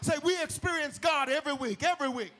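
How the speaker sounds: high, loud preacher crescendo; full-force preaching. Real, not acted. Preacher urging the church to celebrate others, impassioned.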